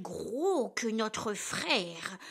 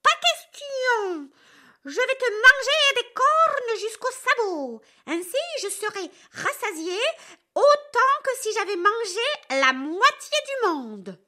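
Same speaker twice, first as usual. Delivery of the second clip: much higher, loud threatening roar; furious troll; 10 semitones higher, 4 dB louder than usual. Acted. Furious troll threatens to devour the big goat, high and loud. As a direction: threaten to eat him whole. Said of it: "devient furieux".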